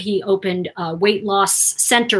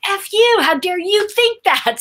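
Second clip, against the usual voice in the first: higher, louder angry comeback; angry retort; 10 semitones higher, 3 dB louder than usual. Retold or imitated. Speaker shouting back at friend, angry. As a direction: throw it right back at him. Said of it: "I go".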